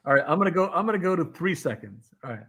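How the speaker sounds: ordinary delivery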